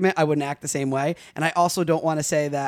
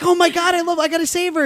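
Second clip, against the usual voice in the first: high, loud hammed-up panic; overacted hero rushing in; mock frantic rescuer; 13 semitones higher, 6 dB louder than usual. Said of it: "you're like".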